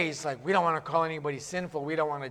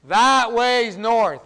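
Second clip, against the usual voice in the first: emphatic pointing out; 6 semitones higher, 8 dB louder, much slower than usual. Pretend. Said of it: "someone says".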